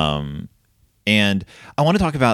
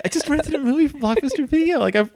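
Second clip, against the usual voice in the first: very high, squeaky mock bewilderment; hammed-up helpless protest, pitched way up; wronged innocence. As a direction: whine like a kid unfairly in trouble. Retold wounded innocence after dad leaves.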